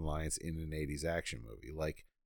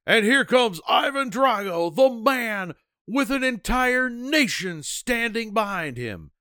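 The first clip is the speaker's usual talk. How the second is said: booming, slow ring announcer voice; announcer impression; 11 dB louder, slower than usual. Podcast host mimics the ring announcer, booming and slow. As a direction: boom it out like a fight announcer. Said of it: "And then he's like".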